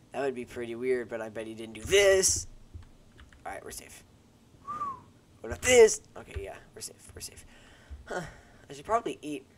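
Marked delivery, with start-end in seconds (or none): 5.4-6.0 high, loud startled question; startled shout. Real, not acted. Spotting something on screen, high and startled. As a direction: jump at a sudden find. Sudden alarm in game.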